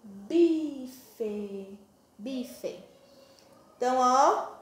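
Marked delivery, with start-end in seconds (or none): 0.0-1.8 bife stretched, both syllables held; slowly sounded out. Shown as a demonstration. Teacher reading the word to children, bife long and slow. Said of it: "a palavrinha que formamos aqui". 2.2-2.8 bife short and softer; read at normal speed. Shown as a demonstration. Teacher repeating the word whole, bife brief and soft. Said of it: "a palavrinha que formamos aqui".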